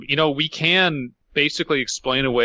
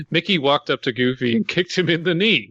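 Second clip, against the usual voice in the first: normal talking voice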